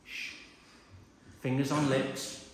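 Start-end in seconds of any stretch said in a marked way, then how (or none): 0.1-0.3 shushing. Shown as a demonstration. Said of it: "So you should say".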